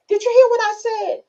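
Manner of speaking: playful high-voiced demand for an answer; mock questioning. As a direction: pitch it way up, testing the listener. Acts out checking on a listener.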